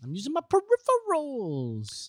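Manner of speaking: high, slow drawn-out silly voice; silly playacting. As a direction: stretch the last word high, playfully. Joking playacting about peripheral vision.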